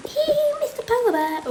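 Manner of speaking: high, fast giggly voice; giggly child. Acted. Child giggling over her polar bear, high voice. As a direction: giggle like a happy kid.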